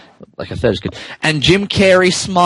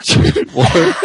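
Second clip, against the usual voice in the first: whispered giggly toot, higher; breathy laugh; 7 semitones higher than usual. Acted. Grinch laughing at his own joke, whispered. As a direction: laugh it out under your breath. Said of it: "and he laughed".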